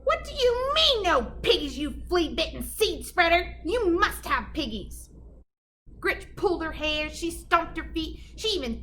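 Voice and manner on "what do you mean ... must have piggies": high, loud furious screech; screaming witch. Acted. Gritch screaming at the cow, high furious screech.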